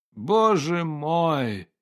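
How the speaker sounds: slow, drawn-out moan of despair; groaning. Acted. Painter groans at the endless cloudy weather, slow moan. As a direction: let it out as a long weary groan. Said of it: "простонал Рябовский".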